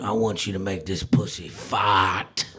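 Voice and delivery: low, slow bedroom murmur; sultry low request.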